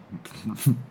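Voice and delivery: short giggle in character; little familiar snicker. A narrator's reading. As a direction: chuckle to yourself, unseen. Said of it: "hörte er ein vertrautes Kichern".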